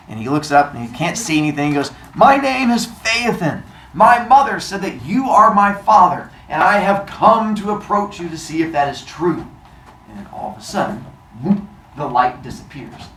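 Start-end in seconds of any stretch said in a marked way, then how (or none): 2.1-9.4 high, loud formal announcement; bold declaration. Acted. Boy addressing his unseen father, bold and declamatory. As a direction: proclaim it up to a god.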